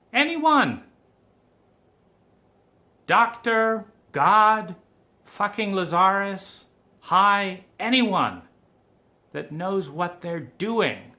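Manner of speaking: high, loud desperate calling out; pleading yell into the void; desperate shout. Real, not acted. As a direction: cry out for anyone who can help. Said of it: "I'm mirroring and I'm going through these stages".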